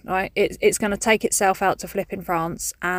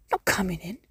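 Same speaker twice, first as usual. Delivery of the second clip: softer, trailing sulky cat echo; stubborn cat repeats; 6 dB quieter than usual. Retold or imitated. Cat repeating its refusal to its owner, softer and sulkier. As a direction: let the cat's refusal fade out. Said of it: "they look at me like that".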